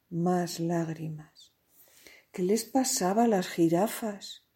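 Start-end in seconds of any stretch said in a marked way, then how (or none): none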